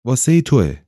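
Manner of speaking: mildly moaned reply; a short, understated moan. A narrator's reading. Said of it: "پروانه ناله کنان گفت".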